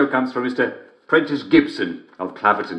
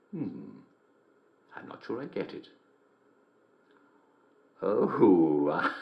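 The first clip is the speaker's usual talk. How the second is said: usual speaking voice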